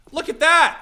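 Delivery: excited shout. Real, not acted. Streamer to chat as the smoke lands, delighted shout.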